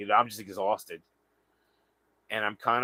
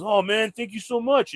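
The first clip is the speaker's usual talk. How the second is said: higher, louder rush of thanks; grateful stranger; 10 semitones higher, 4 dB louder than usual. Retold or imitated. Stranger thanks him for shoes, high grateful voice. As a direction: gush thanks in a raised voice. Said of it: "He goes".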